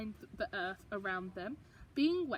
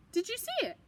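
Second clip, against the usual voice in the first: put-on excited child; 12 semitones higher, faster than usual. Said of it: "I asked".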